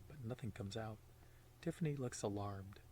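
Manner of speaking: plain reading voice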